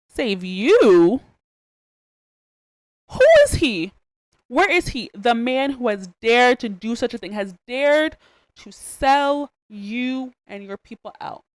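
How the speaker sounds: a king roaring with shock and rage; outraged king. Retold or imitated. King outraged at Haman's plot.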